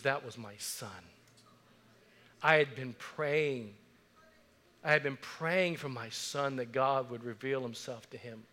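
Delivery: quiet, slow, tearful mother, lightly imitated; retold tearful mother. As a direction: softly retell a grieving mother's words through tears. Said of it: "And she begins to weep".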